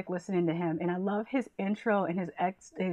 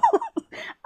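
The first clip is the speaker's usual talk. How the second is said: high burst of laughter. Real, not acted. Laughing at her own joke.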